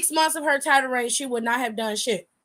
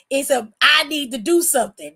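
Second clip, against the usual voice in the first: normal talking voice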